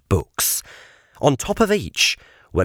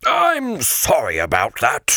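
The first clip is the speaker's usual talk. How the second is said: sighing; 3 semitones higher than usual. Acted. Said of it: "said Sir Regulus with a sigh".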